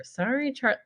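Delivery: ordinary delivery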